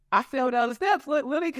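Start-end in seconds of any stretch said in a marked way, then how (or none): none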